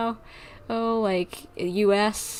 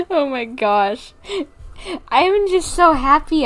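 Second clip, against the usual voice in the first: beaming with joy; 5 semitones higher than usual. Real, not acted. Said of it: "I'm smiling from ear to ear".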